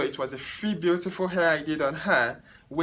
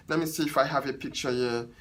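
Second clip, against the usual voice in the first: usual speaking voice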